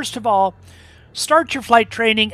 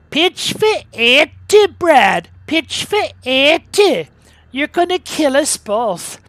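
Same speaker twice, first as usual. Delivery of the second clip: higher, slower Scottish brogue, urgent; 7 semitones higher, 6 dB louder, slower than usual. Retold or imitated. Scottish flight instructor warning his student pilot, higher Scottish brogue. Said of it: "he had a bit of a brogue".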